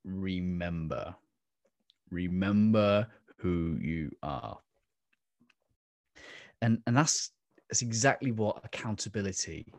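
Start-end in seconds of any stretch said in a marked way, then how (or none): 0.0-4.6 slow, distant voice from the clouds; lightly voiced wise lion king; Mufasa. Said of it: "Mufasa is coming out of the clouds and he is saying".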